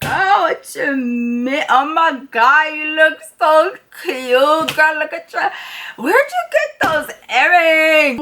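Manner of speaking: put-on slow, high, slurred drunk gushing; drunk slurring. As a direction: stretch every word like a wasted friend.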